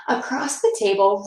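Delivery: plain reading voice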